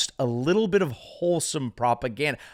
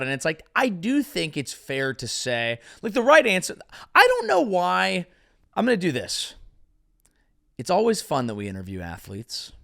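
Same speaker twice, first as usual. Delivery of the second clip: usual speaking voice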